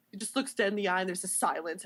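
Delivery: ordinary delivery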